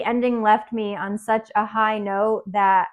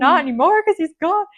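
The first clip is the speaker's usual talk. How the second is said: tearful; 6 semitones higher, 5 dB louder than usual. Real, not acted. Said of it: "I'm getting emotional right now".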